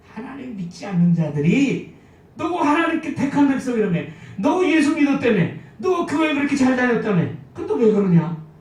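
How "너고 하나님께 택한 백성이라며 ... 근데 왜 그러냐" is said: loud, higher-pitched jeering challenge; mocking taunt. Unbelievers taunting God's chosen people in their suffering, loudly mocking. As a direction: throw accusations in mockery, dropping in pitch on the last question. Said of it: "라고 조롱한다 이 말이에요".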